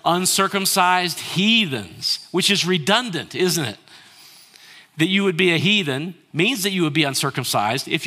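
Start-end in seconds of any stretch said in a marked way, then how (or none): 0.0-2.3 congregation reads aloud together, loud; louder unison reading of the verse; loud group reading. Real, not acted. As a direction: read it out loud with everyone. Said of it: "Say it with me".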